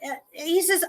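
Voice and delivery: ordinary delivery, nothing marked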